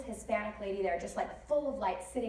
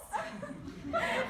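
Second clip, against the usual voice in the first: real laugh. Real, not acted. Laughing at the taxi story.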